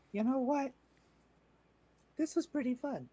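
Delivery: high-pitched character voice; Maggie. Acted. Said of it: "Maggie decides".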